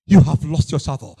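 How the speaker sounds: the same rebuke at full preaching volume; firm rebuke. Acted. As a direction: hit it hard the second time. Repeated rebuke, second take full.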